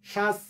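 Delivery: শ্বাস as shas with final s; spelling-based pronunciation. Shown as a demonstration. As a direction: end শ্বাস on a plain hissing s. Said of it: "হওয়ার কথা ছিল কি". (শ্বাস, বানানমতো শেষে দন্ত স)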